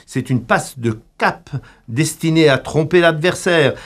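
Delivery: ordinary delivery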